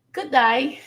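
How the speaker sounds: put-on playful, rough stab at an Australian accent; attempted Australian accent. Livestream host greets a viewer from Perth, attempted Australian accent. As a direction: have a go at Australian, not quite landing it.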